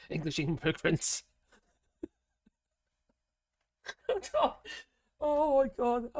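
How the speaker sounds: chuckling through a wry joke; laughing. Real, not acted. Vlogger joking about Welsh blame, soft laughing. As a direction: laugh it out under the breath.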